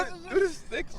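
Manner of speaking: high hyped call. Real, not acted. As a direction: pump up the room. Hyped shout-out.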